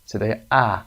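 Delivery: ordinary talk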